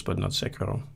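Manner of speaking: ordinary talk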